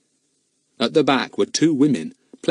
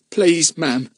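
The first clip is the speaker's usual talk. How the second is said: urgent; 7 semitones higher, 3 dB louder than usual. A narrator's reading. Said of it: "in an urgent voice".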